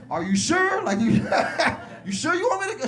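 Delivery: high, loud, laughing through the question; can't believe she asked, cracking up; laughing disbelief. Retold or imitated. Son laughing at mom's church invite, incredulous and laughing.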